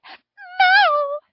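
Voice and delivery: high, loud, breaking into tears; loud tearful cry. A narrator's reading. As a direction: wail it out, heartbroken. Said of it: "she said loudly, almost in tears".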